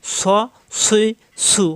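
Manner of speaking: স, সুই, সু thick with a hissing onset; heavy sibilant on স, সুই, সু; whistled heavy s. Shown as a demonstration. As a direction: whistle through a rounded স, সুই, সু. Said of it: "শীষ দিয়ে পড়তে হবে".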